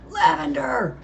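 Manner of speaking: high, loud frantic call; panicked shout for a friend; shouted name. Acted. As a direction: shout her name through the smoke. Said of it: "Dale shouted".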